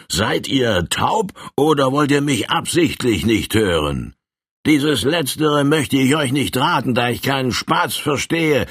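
brash bully giving a warning; threatening colonel. Acted. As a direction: lean in and threaten him with a sneer.

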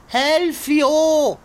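loud, high wail for help; desperate cry. Acted. (verzweifelter Hilferuf)

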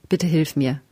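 usual speaking voice

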